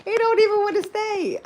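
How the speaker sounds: high, loud squeal of complaint; laughing exasperation. Real, not acted. Exclaiming over a phone that won't stay, laughing exasperation. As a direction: wail it, then burst out laughing.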